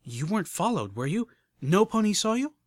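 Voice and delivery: anxious edge creeping in; tense. Acted. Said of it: "a tenseness crept into her voice".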